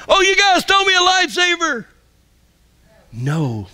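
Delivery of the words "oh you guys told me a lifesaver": very high, hammed-up cry for help; mock shout. Mock Peter sinking in the water, high-pitched shouting. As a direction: yell it in a comic panic. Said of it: "what did he shout?".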